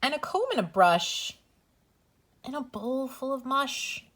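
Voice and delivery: gentle sing-song bedtime read-aloud; reading to children. Acted. Reads bedtime story to children.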